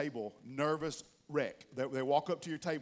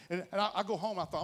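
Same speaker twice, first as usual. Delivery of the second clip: ordinary delivery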